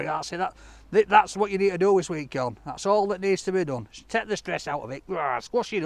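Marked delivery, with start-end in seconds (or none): none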